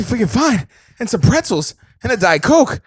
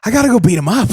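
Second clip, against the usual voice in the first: higher, louder tough-guy bluster; hammed-up action hero; 9 semitones higher, 4 dB louder, faster than usual. Hosts riffing, he plays the star rushing to fight. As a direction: puff up like a movie hero itching to fight. Mock Wahlberg bit continues.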